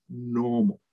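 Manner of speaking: normal said low and soft, set apart; stress on normal. Shown as a demonstration. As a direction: lean deliberately on the word normal. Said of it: "I emphasize that word".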